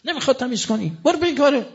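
mock snapping order to go away; retold scolding. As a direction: imitate a driver telling someone off. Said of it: "با دو سه تا تشن گفت".

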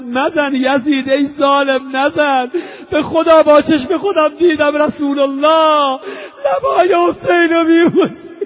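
loud, high wailing plea; impassioned protest collapsing into weeping; tearful outcry. Real, not acted. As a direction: cry out in anguish, breaking into sobs. Weeping preacher voices protester's plea.

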